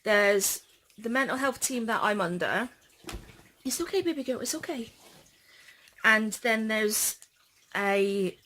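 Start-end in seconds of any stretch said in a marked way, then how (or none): 3.6-4.9 higher, softer soothing coo; gently calm a frightened pet; soft, high comforting murmur. Real, not acted.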